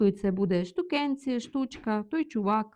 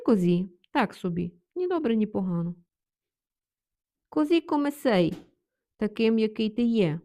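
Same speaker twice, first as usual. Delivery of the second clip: ordinary talk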